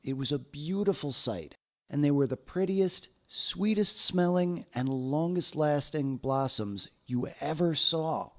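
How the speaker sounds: usual speaking voice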